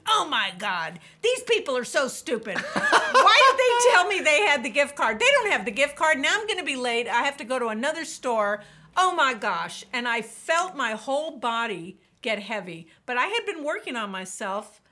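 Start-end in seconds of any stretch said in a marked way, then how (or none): 0.1-9.8 loud, high exasperated rant with laughter; hammed-up fury at useless clerks; angry inner rant. Ranting in his head at the store staff, loud and exasperated. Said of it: "I'm having this whole conversation".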